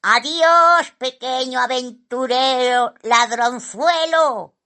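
high, loud magpie call; shouted farewell. A narrator's reading. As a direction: call it out while flying off.

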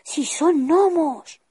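high, slow marvelling cry on gnomos; drawn-out wonder, they really are gnomes; amazed exclamation. Acted. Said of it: "exclamó".

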